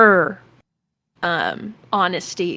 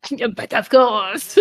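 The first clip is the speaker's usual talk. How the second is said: put-on silly French accent; 3 semitones higher, 3 dB louder than usual. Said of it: "[name] doing a silly French accent going".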